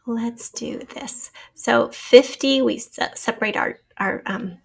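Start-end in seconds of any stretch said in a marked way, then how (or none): 0.0-1.3 slow whisper, no voice; a hushed self-encouraging whisper; whispered aside. Real, not acted. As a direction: mutter it under your breath. Teacher whispers to herself.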